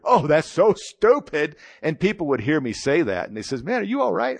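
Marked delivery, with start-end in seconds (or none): 0.0-1.6 loud, high, slow scoff at his own words. Retold or imitated. Mocking his own words on the sidewalk. 3.6-4.4 much higher, a worried stranger checking on him; concerned passerby. Retold or imitated. Passersby asking if he's okay.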